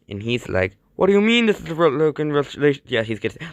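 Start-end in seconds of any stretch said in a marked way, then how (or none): 1.0-2.8 higher, louder, fast disbelief; acted indignant challenge, rushed; indignant protest. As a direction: fire back in mock outrage. Acted indignant reply in a quarrel.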